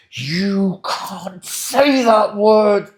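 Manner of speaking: high, loud, slow indignant gasp; mock outrage. High conflict person catching the partner saying a forbidden word, loud mock outrage. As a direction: act scandalised, savouring it.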